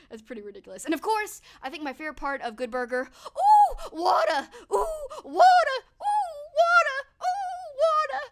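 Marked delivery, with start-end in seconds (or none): 3.3-8.2 put-on shrieking, very high old lady voice, slow and loud; old woman screaming. Streamer imitating Kenan's old woman, very high shrieking scream. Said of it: "pretending to be an old woman and he's, like, screaming for water".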